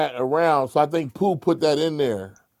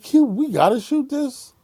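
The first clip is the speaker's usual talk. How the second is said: mock protest; 10 semitones higher than usual. Acting out his protest to [name].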